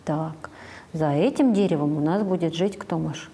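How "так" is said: usual speaking voice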